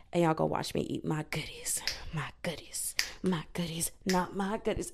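voiceless teasing whisper. Real, not acted. Vlogger teasing listeners about her cookies, whispered. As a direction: whisper it secretly to the audience.

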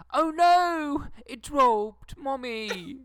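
high, loud childish mock British accent; loud high kid doing a silly British accent in the back seat. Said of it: "bust into a British accent".

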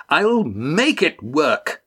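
put-on high, drawn-out childish protest; thwarted child's scream. A narrator's reading. Mufrid defying Estrella, slow childish tantrum. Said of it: "screamed at Estrella like a thwarted child".

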